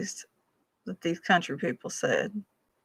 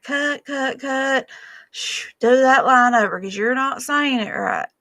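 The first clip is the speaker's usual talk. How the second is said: drama teacher stopping; 5 semitones higher, 4 dB louder than usual. Retold or imitated. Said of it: "She'd be like".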